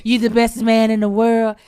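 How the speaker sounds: put-on slurred, loud sweet-talking drunk; town drunk. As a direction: slur it like a drunk. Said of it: "Why you sound like an old drunk".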